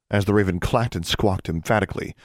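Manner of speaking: plain reading voice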